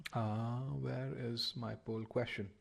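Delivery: soft, slow absent-minded searching; talking to oneself. Real, not acted. Teacher hunting for the poll question, quietly to self. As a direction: think aloud quietly while hunting for something.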